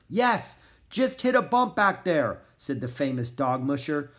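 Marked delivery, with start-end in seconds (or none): 0.1-2.4 high, raised reply; calling back. A narrator's reading. Crashed musher reassuring Annie, calling back. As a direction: answer over the gale.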